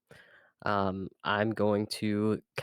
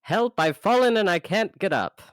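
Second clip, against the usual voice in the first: high, loud comic cry for help; goofy yell; 11 semitones higher, 9 dB louder than usual. Pretend. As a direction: yell it like a silly old TV ad. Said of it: "give me something goofy that you yell".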